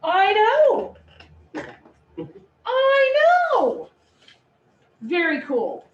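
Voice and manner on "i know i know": excited I know. Real, not acted. Excited agreement with student.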